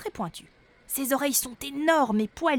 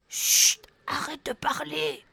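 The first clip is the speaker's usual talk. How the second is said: chut soufflé sans voix; souffle-lui de se taire; chuchotement; 6 semitones higher, 4 dB louder, slower than usual. Acted. Boubou fait taire Flora. (Boubou chuchote à Flora, chuchotement pressant)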